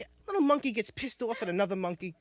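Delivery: normal talking voice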